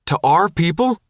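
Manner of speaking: a hint of outraged disbelief; angry. A narrator's reading. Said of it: "Woodman said angrily".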